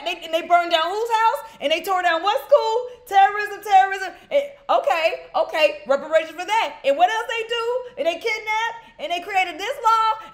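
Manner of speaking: loud, high, incredulous rapid-fire questions; mounting outrage. Acted. Host reacting to each chapter's wrongs, mounting outraged disbelief.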